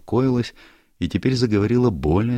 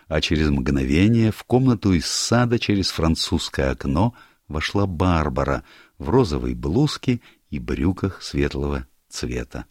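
usual speaking voice

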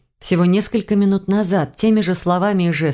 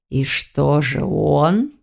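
slow, lower purring cat; 3 semitones lower, much slower than usual. A narrator's reading. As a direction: purr the question lazily. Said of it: "мурлыкающим голосом спрашивал".

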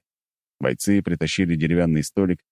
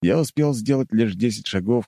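ordinary talk, nothing marked

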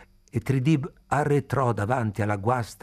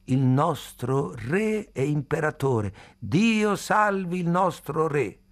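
slow, high-pitched loyal salute; reverent acclamation; 6 semitones higher, slower than usual. A narrator's reading. Old man hailing the king's portrait, exultant. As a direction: proclaim it grandly, drawing out the words.

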